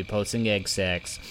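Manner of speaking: ordinary delivery, nothing marked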